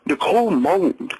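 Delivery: usual speaking voice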